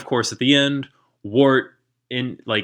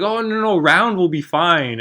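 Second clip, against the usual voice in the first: flustered high voice; 7 semitones higher, 4 dB louder than usual. Retold or imitated. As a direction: squeak it out like flustered young Arthur. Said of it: "He's like".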